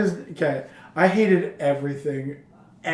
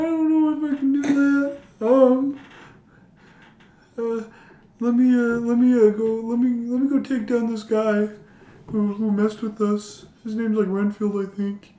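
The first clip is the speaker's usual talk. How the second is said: higher, hesitant son voice; nervous son; 7 semitones higher than usual. Retold or imitated. A son unsure he can face Renfield, voiced higher. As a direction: stall and fumble like a scared kid.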